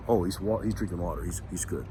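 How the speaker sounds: low, quiet imitation of a guard; soft, low mock self-talk; retold musing aside. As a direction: drop right down, as if thinking aloud. Guard reasoning to himself, retold.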